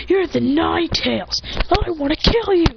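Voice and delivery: high, drawn-out villain threat; threatening villain. Acted.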